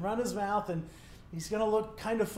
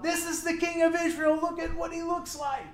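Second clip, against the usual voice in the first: excited soldiers; 9 semitones higher than usual. Acted. Commanders spot the royal robes.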